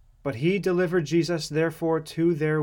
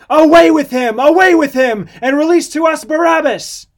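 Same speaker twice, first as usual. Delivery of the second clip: high, very loud mob yell; full-throated repeated cries; crying out; 12 semitones higher, 13 dB louder than usual. A narrator's reading. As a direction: shout the demand as an angry crowd. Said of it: "They cried out".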